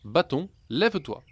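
put-on curious boy trying the stick on the road; blurting out. A narrator's reading. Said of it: "il lâcha".

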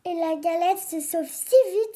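ordinary delivery, nothing marked

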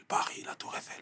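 breathed out in a wondering whisper; dreamy whisper. Retold or imitated. Boy from Marseille dreaming of Paris, whispered. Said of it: "Je me disais".

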